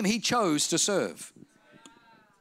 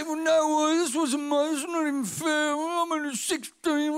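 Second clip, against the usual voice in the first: higher, whiny complaint; mock whining victim; 7 semitones higher than usual. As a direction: play the self-pitying teenager.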